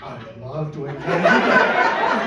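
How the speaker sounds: slow, loud, drawn-out mock rapture; ecstatic declaration. Snorkeler bonding over Korean food, ecstatic. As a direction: stretch it out with laughing, hammed-up delight. Stranger thrilled by shared food love.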